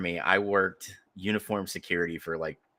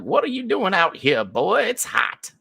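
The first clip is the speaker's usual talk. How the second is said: put-on high, loud old Southern lady; thick Southern accent; 13 semitones higher, 6 dB louder than usual. Old ticket-holder scolding the guard out in July heat, thick Southern accent. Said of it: "Thick Southern accent".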